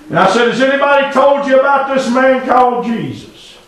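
preacher's rising whoop; rising sermon cry. Real, not acted. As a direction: lift the story into a preaching cry. Retold story, preaching climbs.

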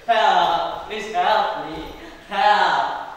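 loud, drawn-out calls for help; shouted plea. Acted. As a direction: shout desperately to anyone within earshot. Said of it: "Shouted the princess".

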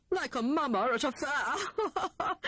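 high, slow mocking words through a laugh; rude laughing jibe. A narrator's reading. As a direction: laugh at her outfit. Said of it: "added Hare rudely".

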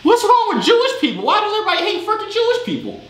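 high-pitched, incredulous protest; exasperated squeal of disbelief; baffled outburst. Real, not acted. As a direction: voice shooting way up in bewilderment. Baffled outburst over others' hatred.

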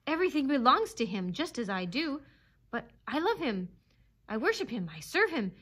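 fervent, high-pitched proclamation; exultant devotion. A narrator's reading. Dog praising his master to the animals, exultant. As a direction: rise with worshipful zeal. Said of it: "quivering with exultation".

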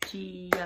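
Giovanni beaten out loudly by syllables. Shown as a demonstration. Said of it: "Try one more time".

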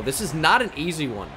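ordinary delivery

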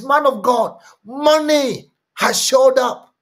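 high, loud, urgent sermon retelling; preaching at full force. Acted. Preacher thunders a church member's phone call, loud and high. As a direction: shout the testimony up to the back pews.

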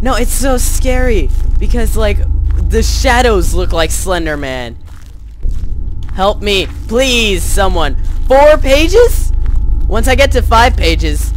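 high, loud and panicky; terrified. Real, not acted. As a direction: voice tight with real fear. Terrified playing Slender.

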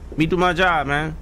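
scared horror game streamer. Real, not acted. Repeats plea, still scared.

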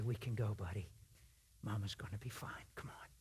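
put-on soft, whispered comfort to a child; tender reassurance. Father telling his son mama will be fine, whispered. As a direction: hush it to a frightened boy. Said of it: "look at your son and say".

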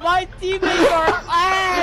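frustrated yell. Real, not acted. Losing the game.

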